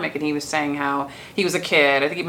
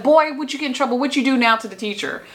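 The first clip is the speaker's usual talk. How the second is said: imitating a scolding mom, much higher; a mock-stern mother demanding answers; 8 semitones higher, faster than usual. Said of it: "So he gets home, she's like".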